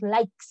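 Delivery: "likes" with a long hissed final S; final S emphasised. Shown as a demonstration. Teacher reminding class of the S, drawing out the end of "likes". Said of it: "usamos la S".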